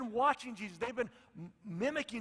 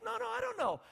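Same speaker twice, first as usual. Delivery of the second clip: pitched way up, whiny no no; high insistent protest; 13 semitones higher than usual. Retold or imitated. Disciple protesting to Jesus, high whiny voice. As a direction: squeak out the denial.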